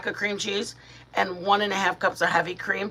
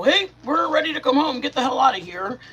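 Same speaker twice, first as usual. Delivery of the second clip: higher, louder mock brush-off; mock rude parent; 6 semitones higher, 5 dB louder than usual. Mother imagines telling her son to leave, mock rude. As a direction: play a pushy parent kicking the son out. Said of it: "I would never go".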